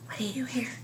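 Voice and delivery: soft unvoiced question; teacher whispering a question to her pupils. Shown as a demonstration. Teacher quizzing children.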